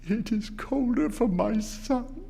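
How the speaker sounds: high, slow, grief-stricken plea; grieving. A narrator's reading. Mother mourning her son, answering her husband, high and grieving. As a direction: let the grief lift the voice high.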